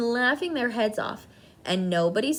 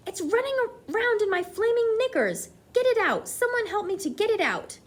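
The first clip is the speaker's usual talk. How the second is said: high, frantic pleading; panicked screaming; 11 semitones higher than usual. A narrator's reading. Cook pleading with the kitchen, frantic and high. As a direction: beg the room in high panic. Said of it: "the man was screaming".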